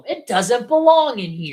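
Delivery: high, slow, indignant insistence; mad rant. Real, not acted. Host objecting to the reference, mad and exasperated. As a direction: protest it word by word. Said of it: "I'm mad about this one".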